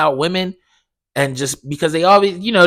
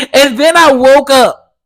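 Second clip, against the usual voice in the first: higher and louder, laughing through it; 8 semitones higher, 6 dB louder than usual. Real, not acted. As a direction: crack up at the punchline. Laughs at own dream story.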